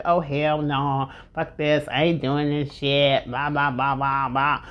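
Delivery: lightly voiced childish tantrum; grumpy tantrum voice. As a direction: play your mind throwing a fit. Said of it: "they'll be like".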